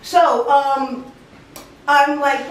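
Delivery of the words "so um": ordinary delivery, nothing marked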